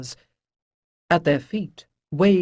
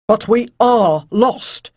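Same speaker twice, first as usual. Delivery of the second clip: slow, louder, higher protest; objecting; 5 semitones higher, 9 dB louder, slower than usual. A narrator's reading. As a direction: push back with heavy insistence. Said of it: "Caloran objected".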